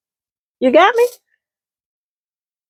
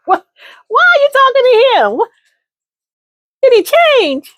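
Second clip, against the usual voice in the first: incredulous friend. Acted. Nosy friend demanding answers, high and shocked. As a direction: grill her in disbelief.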